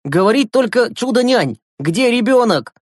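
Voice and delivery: put-on high, emphatic boy giving orders; sharp call to order. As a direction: take command of a noisy bunch. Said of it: "прикрикнул Глеб".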